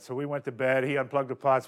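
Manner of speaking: ordinary talk, nothing marked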